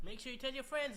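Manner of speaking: high-pitched salesman impression; imitated game hawker. Developer joins in quoting the game's seller, high hawker voice. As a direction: chime in with a high cartoon sales patter. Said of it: "There's a guy selling alien cum".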